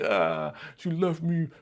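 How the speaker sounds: high, mopey lament; mock heartbroken whine; whining. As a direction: sigh it out like a lovesick fool. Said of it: "He would not be on this like".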